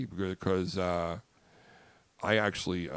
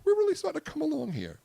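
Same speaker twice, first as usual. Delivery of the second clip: much higher falsetto, quick; faster than usual. Shown as a demonstration. Host shows off his falsetto to co-hosts, quick and high. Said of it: "a high-pitched voice like you guys".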